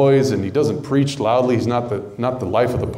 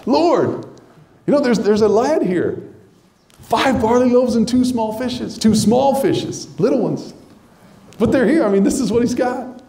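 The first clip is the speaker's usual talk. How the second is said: high, eager offering, smiling; eager Andrew, voice lifted high; hopeful Andrew; 9 semitones higher than usual. Retold or imitated. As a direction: offer it up with a big smile. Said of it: "with a big smile".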